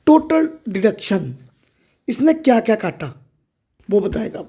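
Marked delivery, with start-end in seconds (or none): none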